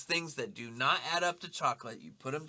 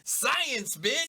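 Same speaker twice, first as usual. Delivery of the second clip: high, louder gleeful catchphrase; mock triumphant shout; 9 semitones higher, 4 dB louder than usual. Topping friend's chemistry line, high mock-triumphant shout. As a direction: crow it like a show-off.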